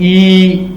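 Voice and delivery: ई with lips spread to the sides; teacher showing spread lips; vowel ee demo. Shown as a demonstration. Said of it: "ऐसे साइडों पे हो रहे हैं".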